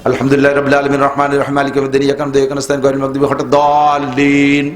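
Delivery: chanted fast without pausing; rushed recitation in one breath. Shown as a demonstration. Preacher mocking the one-breath reciter, rushed chant. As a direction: rattle off the Fatiha in a single breath. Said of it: "এক নিঃশ্বাসে মারিয়ে দিয়েছেন".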